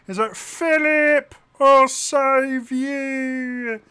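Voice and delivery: very high, loud comic wail; high-pitched hero cry, long drawn out; Gareth. Acted. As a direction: call out in a silly squeal, stretching every word. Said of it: "Gareth talks like this".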